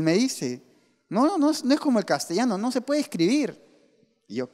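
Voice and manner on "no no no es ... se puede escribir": higher, lightly voiced insistent teacher; imitating the teachers. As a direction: mimic the protesting teachers, pitched up. Teachers insist aymara is hard.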